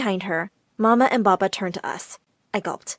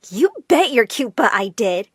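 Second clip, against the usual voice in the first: usual speaking voice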